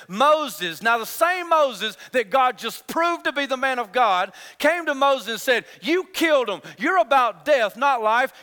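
loud, driving pulpit delivery; fiery sermon; full-force preaching. Acted. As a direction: preach it hard to the congregation.

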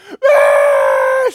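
put-on very high, loud toddler scream; imitated baby cry, high and loud; baby wail. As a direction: let out a baby's crying shriek. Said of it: "She just starts crying".

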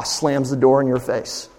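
usual speaking voice

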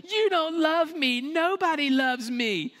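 put-on high, slow sulky kid voice, pouting; mock-sulking little kid complaining; whiny child. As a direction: play the wounded child who was told no. Kid flips it on parent.

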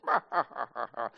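hearty chuckle at his own joke; dad-joke laugh. Acted. Pastor demonstrating his restaurant joke, laughing. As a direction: chuckle heartily after the punchline.

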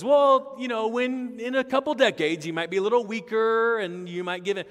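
high, loud caricature of hedging; mock hedging. Mock mild reply Jesus didn't give.

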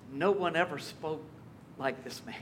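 hushed awe. Retold or imitated. Officers telling the priests of Jesus, soft and reverent. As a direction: let the wonder settle quietly.

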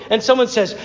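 ordinary talk, nothing marked

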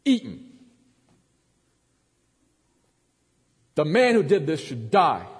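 loud and high, furious; outraged shout. Acted. Preacher voicing David's furious verdict on the rich man.

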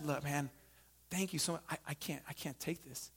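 soft and low, almost murmured; hushed refusal. Retold or imitated. Chaplain quietly refusing the quarterback's truck, hushed.

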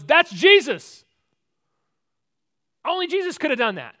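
loud, high cry of recognition; excited shout. Acted. Peter spotting Jesus from the boat, excited shout. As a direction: burst out loudly in amazed excitement.

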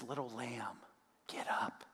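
put-on Jesus whispering the girl back to life; tender whisper. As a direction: coax her gently awake. Jesus raises the dead girl.

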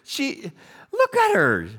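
high, loud complaint to God; a husband at his wits' end, pitched high; exasperated husband. Acted. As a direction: throw up your hands at God. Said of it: "Hosea goes".

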